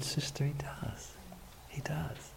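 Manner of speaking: slow, tender whispered reassurance; gentle whisper. Retold or imitated. Pastor reassuring the crying sister, whispering slowly. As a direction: soothe her in a whisper.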